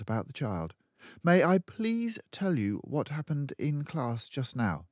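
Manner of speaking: put-on high, polite young teacher; high pleading teacher; timid teacher. A narrator's reading. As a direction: stand firm though nervous. Miss Honey pleads.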